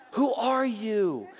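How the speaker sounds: slow, high-pitched amazed question; astonished. Retold or imitated. Couple amazed by the stranger's Persian history, astonished. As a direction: draw the question out in mock amazement. Stunned couple reacts to pastor's knowledge.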